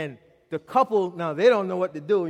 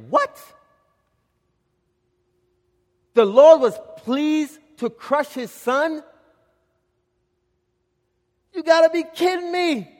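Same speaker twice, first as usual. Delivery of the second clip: high-pitched, drawn-out disbelief; incredulous; 8 semitones higher than usual. Acted. Preacher reacting to God crushing his son, incredulous. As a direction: stop short, stunned, voice jumping up high.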